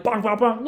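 high, punchy "bonk" sounds; banging noises. Retold or imitated. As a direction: mimic fists pounding on a door. Imitating mob banging on the door.

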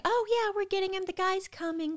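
high, singsong placating voice; breezy fobbing-off. Retold or imitated. Hospital staff stalling on the epidural, breezy fobbing-off. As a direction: imitate staff brushing off a worried patient.

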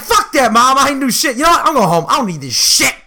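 indignant retort, starting high; upset outburst. Retold or imitated. Junior answering Mom before going home, upset mock outburst. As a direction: protest like you're storming out. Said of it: "I get really upset".

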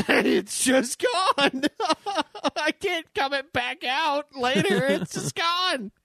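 slow, high words squeezed out through giggles; real laughter carrying the words; laughing. Real, not acted. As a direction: still cracking up mid-sentence. Cracking up over the joke.

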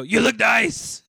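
loud, slow shouted compliment; German guy yelling. Retold or imitated. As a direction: bellow it like an angry compliment. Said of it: "Doesn't he just go".

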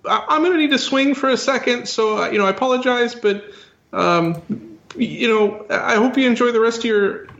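high-voiced, flustered [name] stalling with ums; [name], nervous apologizer. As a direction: apologize awkwardly in a high falsetto.

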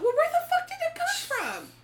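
high, loud retold bewilderment; baffled outburst. Host relives her thought about the toad, loudly bewildered. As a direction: exclaim it, voice leaping up.

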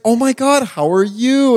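high, sweet, extra-clear greeting; imitated customer-service gush. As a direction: greet an older person with put-on cheer. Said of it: "it's like customer service voice".

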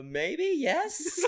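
hesitant maybe, breaking into a laugh on yes; unsure laughing answer. Retold or imitated. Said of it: "I'm like".

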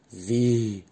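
mildly astonished question; understated surprise, one drawn-out word. A narrator's reading. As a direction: ask it with a touch of amazement. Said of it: "fragte die Blume erstaunt".

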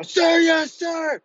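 very high, loud shout; screaming reply. Retold or imitated. Recruit screams over instructor's order to shut up, shouted. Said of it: "just screaming as loud as I can".

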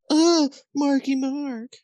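high, slow, swooning "[name]"; swooning fan voice. Pretend. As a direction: coo the name like a smitten fan. Teasing mock swoon.

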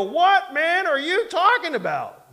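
loud, high, incredulous outburst; mock the shocked critics' disbelief; outraged disbelief. Preacher mimics upset listeners in loud, high disbelief. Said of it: "some people heard that and they go".

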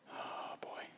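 barely audible whisper; whispered dismay. Retold or imitated. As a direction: breathe it out as if in trouble. Reacting to being caught out.

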